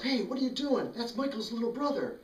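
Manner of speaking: put-on high, urgent kid voice; a schoolkid stepping in to stop a bully; kid protesting. Kid defending Michael's little brother, high and urgent.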